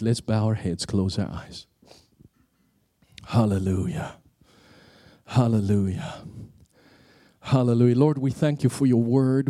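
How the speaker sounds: gentle pastor's prayer, heads bowed; closing prayer. Acted.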